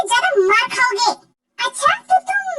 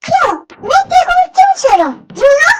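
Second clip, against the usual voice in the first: loud pleading; 3 semitones higher, 5 dB louder than usual. Acted. Pleading to be let go.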